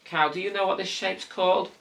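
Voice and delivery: high, playful question to a toy; talking to a toy. Acted. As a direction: ask the toy cow sweetly. Asking the toy cow.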